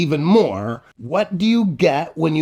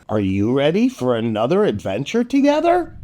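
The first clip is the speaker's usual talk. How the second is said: ordinary delivery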